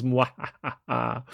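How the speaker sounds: slow mock villain cackle; gloating mwahaha; evil laugh. Villain laugh while reading comment.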